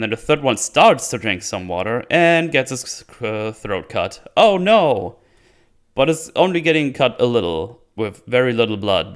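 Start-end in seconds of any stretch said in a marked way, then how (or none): 4.4-5.2 high, loud mock dismay; mock horror. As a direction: cry out in fake alarm. Mocks the tame throat cut.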